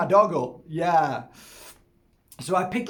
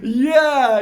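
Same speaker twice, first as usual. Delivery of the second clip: cry-laughing yeah, much higher and louder; laughing hard; 11 semitones higher, 14 dB louder than usual. Real, not acted. Man laughing at his own joke, cry-laughing. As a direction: squeal through helpless laughter.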